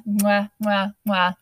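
playful kiss sounds; blowing kisses. Real, not acted. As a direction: smack out air kisses. Signing off to listeners.

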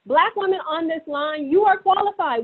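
high-pitched, emphatic rallying; rallying encouragement. Real, not acted. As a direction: urge the listeners on with real conviction. Rallying women on the call to run.

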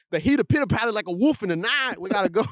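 put-on high, theatrical old-time storyteller; mock-dramatic historical impression; Harriet Tubman. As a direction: declaim it in a caricatured Southern dialect. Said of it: "That's exactly how she talked".

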